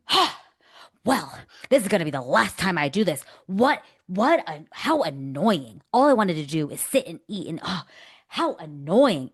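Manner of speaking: hammed-up huffy complaint, loud and slow on key words; annoyed. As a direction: imitate an exasperated woman making a fuss. Acts out annoyed woman at bar.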